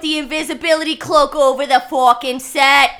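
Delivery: loud, exasperated outburst; hammed-up anger. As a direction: bellow it like a fed-up performer on set. Acted outburst at the interruption.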